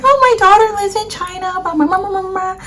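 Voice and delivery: high, bubbly imitation of mom. Daughter playing her proud mom to designer, high and bubbly. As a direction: pitch way up to play proud mom.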